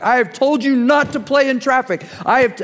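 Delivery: high scolding mother's cry; panicked mom. Retold or imitated. Mom scolding her son lying in the road, high and frantic. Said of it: "and she's like".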